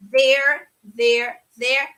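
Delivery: "There" with the tongue between the teeth. Shown as a demonstration. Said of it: "Língua pra fora".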